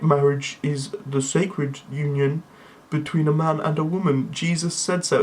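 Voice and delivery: imitating an emotionless friend's drone; Windsor. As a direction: recite it deadpan on one level note. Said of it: "will go much like this".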